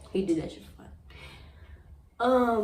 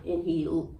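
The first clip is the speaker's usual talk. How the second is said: ordinary talk, nothing marked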